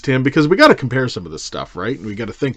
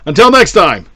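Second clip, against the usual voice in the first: put-on Cockney accent; 7 semitones higher, 8 dB louder than usual. Cockney sign-off, says governor.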